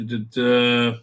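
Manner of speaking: ordinary delivery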